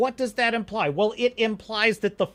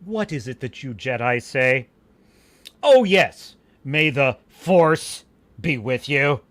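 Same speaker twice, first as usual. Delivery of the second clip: sneering senator; 5 semitones lower, 4 dB louder than usual. Acted. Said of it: "He says".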